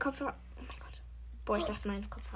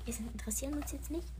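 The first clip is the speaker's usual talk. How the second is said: whisper; 2 semitones higher, 8 dB quieter, faster than usual. Real, not acted. Aside during headphone challenge.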